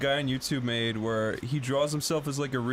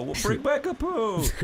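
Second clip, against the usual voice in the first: mock audience plea; 7 semitones higher than usual. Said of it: "they go".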